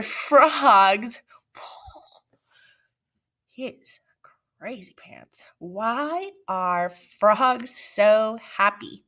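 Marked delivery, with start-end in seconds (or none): 3.5-5.4 hushed silly aside; whispering. Real, not acted. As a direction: whisper it conspiratorially.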